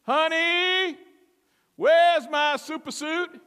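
high, loud, drawn-out call; Frozone shouting. Acted. Husband calling to his wife about his suit, shouting. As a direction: holler across the house, dragging the words. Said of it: "The Incredibles".

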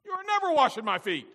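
high, loud, defiant refusal; shouted rebuke. Acted. Peter rebuking the Son of God, defiant shout.

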